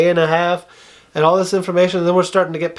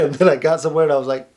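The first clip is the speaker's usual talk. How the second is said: normal talking voice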